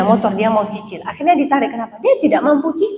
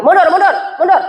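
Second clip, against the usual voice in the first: loud, higher calls of mundur mundur; parking attendant calling; 5 semitones higher, 3 dB louder, faster than usual. Retold or imitated. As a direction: wave the car back like a parking attendant. Parking attendant voiced directing car. (tukang parkir berteriak mundur)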